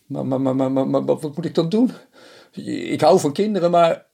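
high, loud stammering panic; panicked stammer. A narrator's reading. Reynold panics about screaming babies, high and stammering. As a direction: stumble over the words in alarm.

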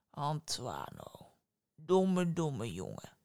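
a hint of a sorrowful giant; lightly voiced giant. Giant sadly chides the foolish boy.

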